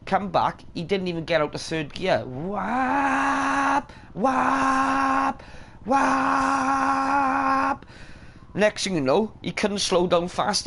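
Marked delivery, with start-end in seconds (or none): 2.3-7.9 slap sound. Retold or imitated. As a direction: shout the impact noise. Imitating bike sound, shouted.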